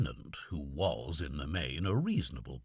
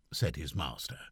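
ordinary delivery, nothing marked

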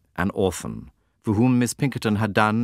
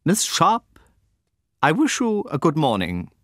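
high, louder, lofty farewell; haughty dismissal; 9 semitones higher, 3 dB louder than usual. A narrator's reading. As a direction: toss her head and dismiss her.

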